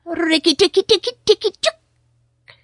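high, chattering battle cry; mongoose war cry. Acted. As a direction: shriek it out like a tiny fierce animal. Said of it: "his long war cry".

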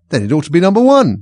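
high, loud triumphant retort; arguing back. A narrator's reading. Alice catching out the king, high loud retort.

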